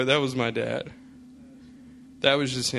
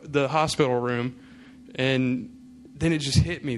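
ordinary talk, nothing marked

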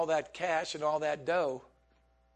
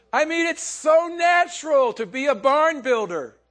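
much louder, higher, half shouted; shouting through laughter; 7 semitones higher, 11 dB louder than usual. Real, not acted. Preacher shouting a point to the church, loud. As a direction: belt it out to the congregation.

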